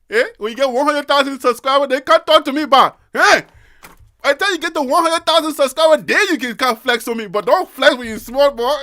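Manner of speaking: mock-boastful Liberian street talk; Liberian big-boy accent. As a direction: scold a small boy in a hammed-up Liberian accent. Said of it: "when you did the small boy, big boy accent".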